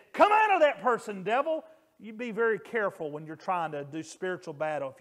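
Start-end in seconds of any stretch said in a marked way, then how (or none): 0.1-1.6 high, loud imagined exorcism command; hammed-up rebuke of the devil; mock rebuke. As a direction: bark it like a bold preacher rebuking. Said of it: "I would have said".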